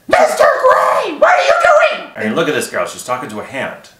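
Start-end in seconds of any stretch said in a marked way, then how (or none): 0.1-2.1 very high, slow, shouted call; high puppet voice. Shown as a demonstration. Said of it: "an old man voice".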